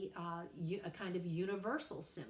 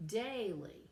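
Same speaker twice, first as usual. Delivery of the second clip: "daily" with DAI punched higher, -ly falling away; stress on first syllable; 3 semitones higher than usual. Shown as a demonstration. Teacher showing a trochaic foot, "daily" stressed on DAI. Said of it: "the stress is on the first one".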